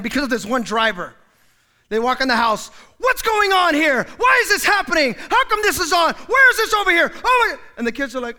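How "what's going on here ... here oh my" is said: high, loud demanding shouts; angry parent yelling. Acted. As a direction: storm in and bark questions.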